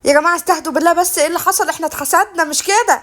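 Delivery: loud appeal at normal pitch; loud intervention. Acted. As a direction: cut through the row to calm everyone. Breaking up a loud family quarrel.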